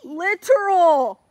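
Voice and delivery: "Literal" said slow, loud and drawn out; insistent correction. Real, not acted. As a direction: hammer the word "Literal" to correct her. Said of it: "Get my vocabulary".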